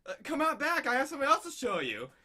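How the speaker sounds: high excited voice. Retold or imitated. Eager proposal setup, calling her back, high and loud. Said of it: "she's like".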